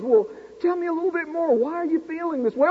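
high, quick, soft therapist voice; calm professor. Retold or imitated. Professor coaxing the upset student, soft and quick. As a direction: coax him gently and quickly. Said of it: "He says".

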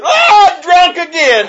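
high, loud, slow tearful wail; hammed-up sobbing cry of a shocked wife. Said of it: "burst out in tears and said".

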